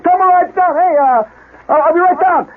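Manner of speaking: high, strained holler from the shower; frantic yell back. Retold or imitated. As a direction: shout it downstairs, stretching the last words. Dad yells downstairs while bleeding.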